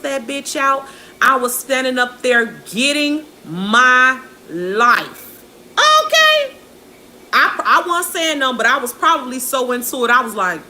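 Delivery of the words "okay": very high, loud squeal; squealed okay. Real, not acted. Vlogger cheering her mother on, high squeal. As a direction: shriek it with delight. Emphatic exclamation.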